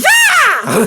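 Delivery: high whooping laugh-shout. Retold or imitated. Friend parodying the ad ending, high whooping laugh.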